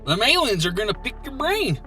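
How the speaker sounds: normal talking voice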